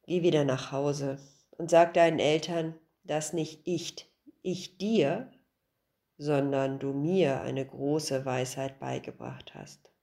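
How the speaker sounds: a sighing sage handing down wisdom; wise old master. Acted. Old master's wise closing words. (tiefe, ruhige Stimme des weisen Alten)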